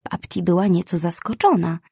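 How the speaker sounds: plain reading voice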